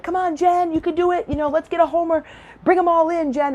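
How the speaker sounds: high-pitched cheer from the stands, held back in volume; imitated cheering yell. Parent in the stands cheers Jen at bat, imitated high cheering call. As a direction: call it out like a fan urging on the batter. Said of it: "who's yelling my name".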